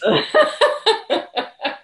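high loud laugh; real high laughter. Real, not acted. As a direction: laugh at the punchline. Laughing at forgotten popsicles.

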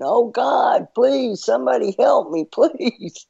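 high, laughing-edged plea for help; mock screaming. Man on the cliff still begging, mock screaming. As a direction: pitch it way up as a comic desperate cry. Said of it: "he kept going".

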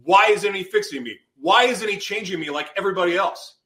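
furious questioning; angry. Retold or imitated. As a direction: demand it in bitter frustration. Retold rage at God.

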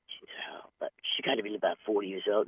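hushed whisper of gossip; low gossiping whisper; whispered aside. Retold or imitated. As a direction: whisper it behind her back. Men's aside.

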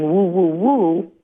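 lightly imitated woofing; dog barking. Dog barking at a person outside, lightly imitated barking. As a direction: woof like a dog, half-spoken. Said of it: "and he's barking".

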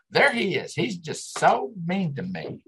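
high whiny imitation of the wife; a mock pout, complaining he is mean; imitating her whining. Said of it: "And she's like".

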